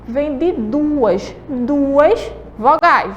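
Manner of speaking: very slow, deliberate explanation. Shown as a demonstration. Teacher to pupils, very slowly explaining the term. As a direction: spell it out word by word. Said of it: "pra facilitar aí".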